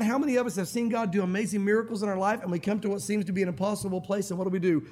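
ordinary delivery

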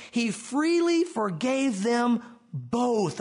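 "both" set apart and stretched out; slow down and land emphatically on "both"; stress on "both". Shown as a demonstration. Preacher reading scripture, drawing attention to "both" with heavy stress. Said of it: "Again, emphatic here".

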